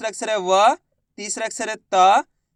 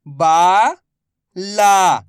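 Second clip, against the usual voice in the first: very slow, each syllable drawn out; much slower than usual. Shown as a demonstration. Teacher sounding out a word for pupils, slow syllables. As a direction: spell the word out slowly for a child. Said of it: "मैं किस तरीके से".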